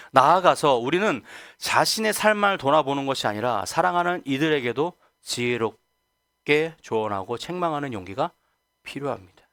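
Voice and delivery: ordinary talk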